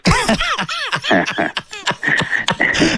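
high-pitched burst of laughter. Real, not acted. Host cracking up at the joke, laughing.

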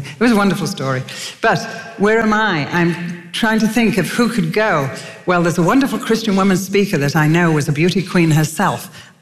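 usual speaking voice

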